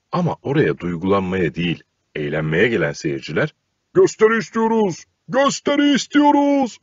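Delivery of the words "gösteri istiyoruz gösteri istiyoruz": high, loud chanting demand; shouting crowd. A narrator's reading. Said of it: "diye bağırmaya koyulmuş".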